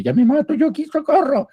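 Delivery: high, frantic call for help from a cliff edge; desperate high yell, hanging from a branch; panicked cry for help. Acted. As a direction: shout up from the precipice in panic. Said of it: "Y dice".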